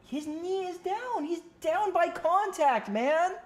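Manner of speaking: high, slow, drawn-out protest; exasperated insistence. Real, not acted. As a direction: stretch each word out in exasperation. Insisting the catch counts.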